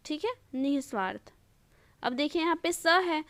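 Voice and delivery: normal talking voice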